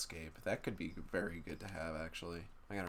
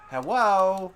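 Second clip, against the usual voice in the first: loud hello; 10 semitones higher, 15 dB louder than usual. Pretend. Greeting enemy in game.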